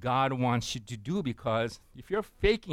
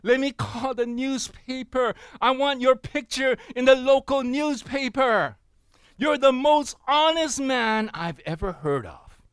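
high, loud, hammed-up delight; thrilled manager; 9 semitones higher, 6 dB louder than usual. Preacher voicing a manager praising an honest customer, acted thrill. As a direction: gush like an overjoyed manager, pitched way up. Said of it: "the manager was thrilled to death".

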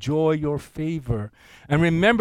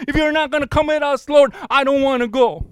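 higher and louder, a frantic plea to the Lord; panicked plea; 10 semitones higher, 7 dB louder than usual. Retold or imitated. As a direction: play Moses begging in alarm, pitched up. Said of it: "he starts freaking out".

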